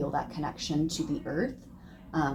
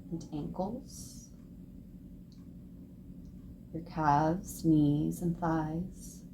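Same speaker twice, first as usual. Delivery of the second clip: guided meditation. Acted. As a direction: ease them down limb by limb. Leads a guided relaxation.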